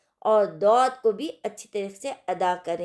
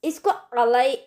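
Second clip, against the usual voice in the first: ordinary delivery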